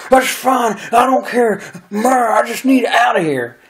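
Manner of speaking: high, frightened imitation rising to a shout; desperate high plea. Friend pleading for a ride out, mock desperate high voice.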